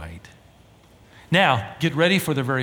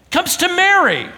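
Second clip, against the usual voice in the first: usual speaking voice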